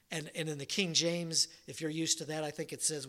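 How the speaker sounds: normal talking voice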